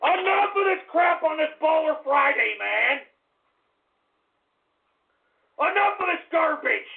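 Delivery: fed up and venting at the chat; angry rant. Acted. Host enraged by chat shout-outs.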